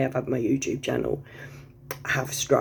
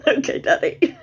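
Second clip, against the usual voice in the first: high, laughing flirty okay; giggly reply; 11 semitones higher, 5 dB louder than usual. Retold or imitated. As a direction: giggle it out coyly. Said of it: "And I'm like".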